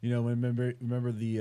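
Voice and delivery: usual speaking voice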